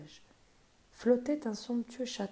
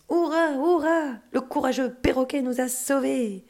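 high, loud joyful cheer; 5 semitones higher, 5 dB louder than usual. Acted. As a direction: shout hurrah for the hero. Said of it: "Tous les animaux l'acclamaient".

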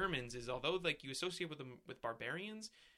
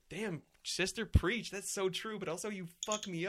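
higher and louder, mock cheering her on; hyped-up agreement; 6 semitones higher, 4 dB louder than usual. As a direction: play a fan shouting support. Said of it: "And I was like".

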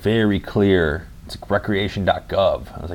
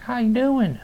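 much higher, bright greeting of a server; high cheerful waitress. Retold or imitated. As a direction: chirp it like a friendly diner waitress.

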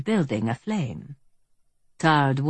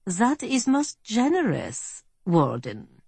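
put-on slow, higher German-accented courtesy; mock-courteous German villain, pitched up; 7 semitones higher, slower than usual. A narrator's reading. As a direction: draw it out in a refined German accent. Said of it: "said the German".

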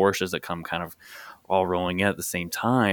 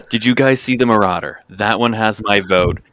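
louder, gushing over the car; excited gushing; 8 dB louder than usual. Retold or imitated. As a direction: rave about the Marauder, loud and eager. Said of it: "You came over and you're like".

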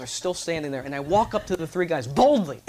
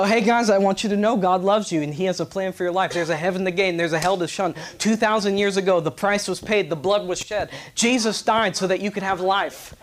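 lightly louder street preaching; a hint of street preacher urgency; preaching the gospel; 2 semitones higher, 4 dB louder than usual. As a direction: preach the gospel to a group on the lawn. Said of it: "I preached the gospel to him".

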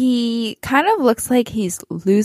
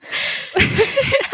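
high breathy laugh. Real, not acted. Laughing at the maid of honor line.

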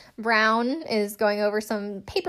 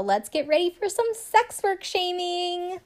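sarcastic; 9 semitones higher than usual. Real, not acted.

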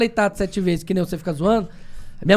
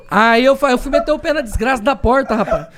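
ordinary talk, nothing marked

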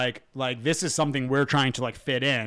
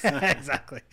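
normal talking voice

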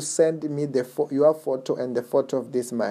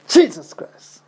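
loud, high cry of the name; preaching shout; 7 semitones higher, 9 dB louder, slower than usual. Real, not acted. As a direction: burst out with the name in prayer. Preacher calls out during prayer.

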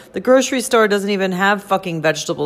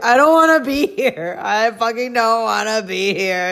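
higher, whiny "I don't wanna be here"; despairing inner voice; 3 semitones higher than usual. Retold or imitated. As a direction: replay the dread of arriving, pleading. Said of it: "is what it felt like".

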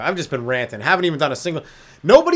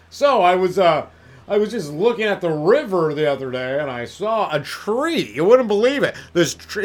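mock radio DJ storytelling; 2 semitones higher than usual. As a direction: parody of a radio DJ telling a story about a tree. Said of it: "do you tell stories like that".

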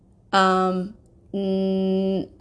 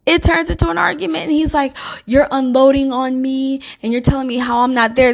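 heated; 5 semitones higher, 6 dB louder than usual. Real, not acted. Argument retold, raised voice.

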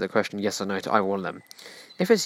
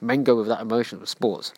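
ordinary delivery

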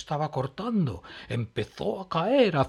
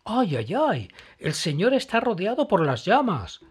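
high, louder worried cry; alarmed; 8 semitones higher, 6 dB louder than usual. Acted. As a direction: gasp in alarm at the flames. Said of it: "dijo Emma".

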